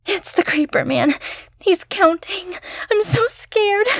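put-on high, tearful terrified girl; scared sobbing girl. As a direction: whimper it through fear. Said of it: "She began quietly sobbing".

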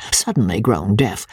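ordinary delivery, nothing marked

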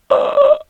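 loud startled gasp; a sudden, audible intake of breath; sharp gasp. Real, not acted. Vlogger finds the message missing from the app, gasp.

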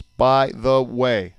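"By the way" very slowly, one syllable at a time; counting syllables. Shown as a demonstration. Dad counting the syllables for his co-host, "By the way" slow and separated. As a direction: space out each word of "By the way". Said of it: "three syllables".

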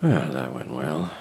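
dry self-mockery; rueful aside; wry mutter. Alone after rejection.

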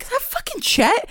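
high-pitched, disbelieving question; mock double take at the screen; startled recognition on TV; retold surprise. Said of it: "I was like".

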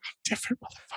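whispered and very soft; breathy whisper. Pretend. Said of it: "It's like".

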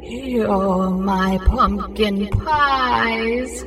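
extremely slow, drawn-out spooky moan; ghost voice. A narrator's reading. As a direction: stretch every word into a haunting. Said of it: "heard the ghost say".

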